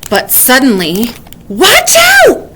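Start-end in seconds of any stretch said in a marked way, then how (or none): 1.5-2.5 high, loud bird's warning call; shrill-free high bird cry of alarm; woodpecker warning. A narrator's reading. Woodpecker calling down a warning from the tree. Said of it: "warned a woodpecker".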